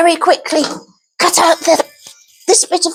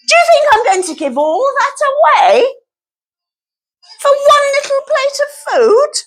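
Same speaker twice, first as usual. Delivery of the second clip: much higher, outraged character voice; high indignant voice; 9 semitones higher than usual. Acted. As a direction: squeak it out, offended.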